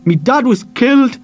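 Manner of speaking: high, loud words like a hammer blow; tearful boy striking back. Acted. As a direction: hit him with it. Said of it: "like he was hitting the man with a big hammer".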